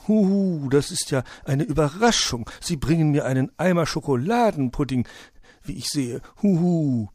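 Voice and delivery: high, loud hooting owl voice; delighted owl character. Acted. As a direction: hoot with surprise at the guest's gift. Owl exclaims over guest's gift.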